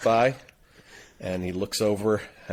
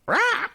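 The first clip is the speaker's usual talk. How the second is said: very high parrot screech; parrot squawk; 3 dB louder than usual. Acted. Parrot to a passing guy, squawking. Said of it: "the parrot looks at him and goes".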